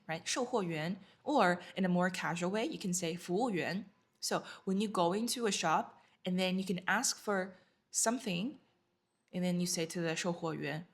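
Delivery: ordinary talk, nothing marked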